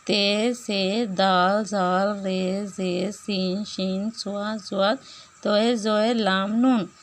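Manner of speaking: slow, deliberate letter names one by one; slow letter recitation. Shown as a demonstration. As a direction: recite each letter like a teacher drilling. Recites sun letters slowly.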